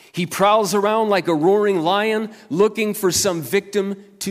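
loud, high, emphatic scripture warning; forceful preaching. Acted. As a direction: sound the alarm to the congregation. Reads a warning, preaching at full force.

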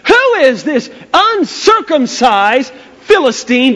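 high, slow, scornful question; indignant. Acted. David scorns Goliath before the army, acted indignation. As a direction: act out offended outrage, stretching the insult. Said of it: "he was indignant about the whole thing".

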